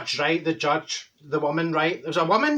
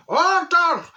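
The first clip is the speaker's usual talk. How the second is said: higher, louder cry of Order; shouting order; 12 semitones higher, 6 dB louder than usual. Retold or imitated. Judge losing patience with the juror, shouted. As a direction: yell it over the chatter.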